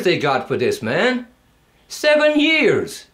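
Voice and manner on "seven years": Seven years much higher and slow; outraged disbelief. Real, not acted. Outraged at the sentence.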